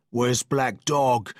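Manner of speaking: slow, drawn-out cry of a sick man. A narrator's reading. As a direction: call out the name slowly from the sickbed.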